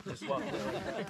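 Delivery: usual speaking voice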